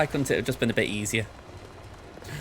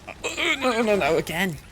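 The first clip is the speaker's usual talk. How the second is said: alarmed cry; 10 semitones higher, slower than usual. Real, not acted. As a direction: cry out as it slips away from you. Game character slips and takes damage again.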